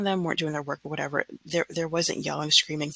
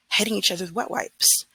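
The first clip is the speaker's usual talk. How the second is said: ordinary delivery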